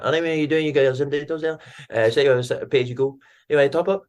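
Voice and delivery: put-on high, garbled Scottish accent; incomprehensible Scottish shopkeeper. Shop staff selling phone credit, garbled Scottish impression. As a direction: imitate baffling Scots shop patter. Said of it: "I can't understand anything anyone was saying".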